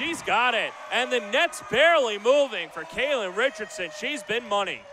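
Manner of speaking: loud, high play-by-play call; excited shout. Real, not acted. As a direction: belt it out as the shot drops. Announcer calls a made basket.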